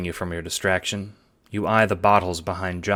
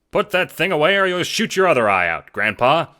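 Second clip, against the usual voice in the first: higher, louder smart-aleck voice; cocky taunt; 10 semitones higher, 7 dB louder than usual. A narrator's reading. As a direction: needle the old man with a grin. Stranger mocks old Jacob.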